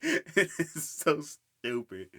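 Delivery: laughing hard, high and slow; loud high laugh trailing into words; real laughter. Real, not acted. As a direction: crack up helplessly. Cracking up at the show.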